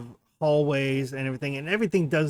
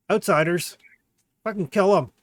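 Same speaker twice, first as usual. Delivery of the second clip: lightly voiced old dwarf, louder and higher; a hint of old dwarf, raised in alarm; 5 semitones higher, 6 dB louder than usual. Old dwarf behind the door hears of outsiders, lightly voiced. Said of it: "this old dwarven voice".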